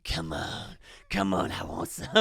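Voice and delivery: low, menacing taunt, lightly voiced; low Italian-American taunt; tough guy challenge. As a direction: dare him to fight, low and steady. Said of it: "the Italian guy was like".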